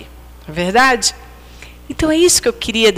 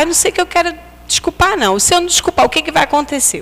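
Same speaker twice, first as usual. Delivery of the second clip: put-on higher, quick little boy's worried question; childlike high voice; 7 semitones higher, much faster than usual. A mother voicing her small son, higher and quick. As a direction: speak like a four-year-old unsure about forgiving. Son asks what happens.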